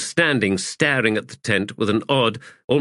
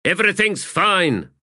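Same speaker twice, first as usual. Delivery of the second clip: raised, higher call through the tent flap; 11 semitones higher than usual. Acted. Paran calls to soldiers outside, raised voice. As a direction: call out to reassure those outside. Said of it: "called out".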